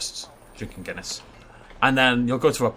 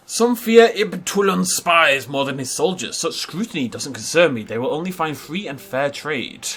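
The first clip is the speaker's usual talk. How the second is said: lore reading; 2 semitones higher, 5 dB louder than usual. Acted. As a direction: narrate the legend like a documentary. Reads game lore aloud.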